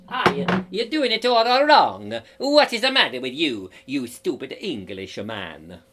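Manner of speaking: mock-outraged Italian accent. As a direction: scold like a cartoon Italian. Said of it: "Or is it Italian?".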